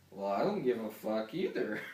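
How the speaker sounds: usual speaking voice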